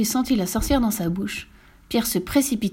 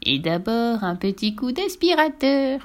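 slow, teasing witch; gloating, leisurely witch voice; sly sneering witch; 3 semitones higher than usual. Acted. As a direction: draw out the threat slyly. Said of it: "ricana-t-elle sournoisement".

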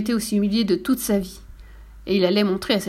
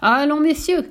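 appel plus fort et plus haut; lancez-le à toute la salle; cri du directeur; 6 semitones higher, 5 dB louder, slower than usual. Acted. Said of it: "cria le directeur". (le directeur crie au public, plus fort)

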